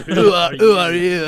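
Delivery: high, loud mock question; game character impression. Mimicking that guy from Valhalla, high and loud. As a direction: blurt the line in a put-on character voice. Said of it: "it'll be that guy from Valhalla".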